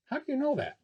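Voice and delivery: put-on high, eager little boy; a wide-eyed kid asking; young boy voice. Curious boy questioning the lady pilot, high and eager.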